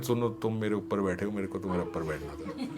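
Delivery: soft, giggly secret in the ear; hushed, playful ear-whisper. Retold or imitated. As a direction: lean in and murmur it with a laugh. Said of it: "कान में बोल रहे हैं".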